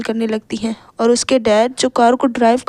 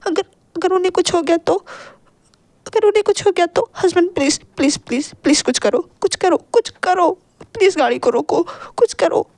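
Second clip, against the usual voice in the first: tearful, stammering, pitched higher as she begs; crying plea; 5 semitones higher than usual. Acted. Wife begs husband to save the children, crying. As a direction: beg through tears, voice climbing. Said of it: "लगभग रोते हुए कहती हैं".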